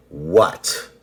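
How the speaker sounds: loud, rising question; acted-out startled retort; taken-aback challenge. Acted reaction to her reaction.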